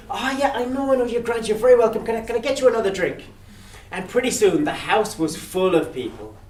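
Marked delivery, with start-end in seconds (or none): 0.1-3.2 high, eager brush-off; breezy host. Acted. Hamish brushing Death off, offering another drink. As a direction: wave him off cheerfully.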